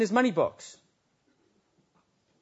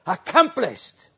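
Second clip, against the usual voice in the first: cried out; 5 semitones higher, slower than usual. Acted. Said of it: "he cried out".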